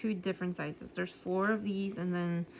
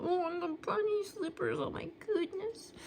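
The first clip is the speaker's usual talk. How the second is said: high, squealing gush over something cute; high-pitched cute-overload squeal; squeal of delight; 11 semitones higher than usual. Real, not acted. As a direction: coo at the cute slippers, pitched way up. Spots cute bunny slippers sticker.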